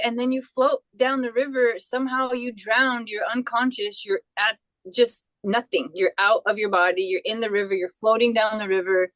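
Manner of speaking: usual speaking voice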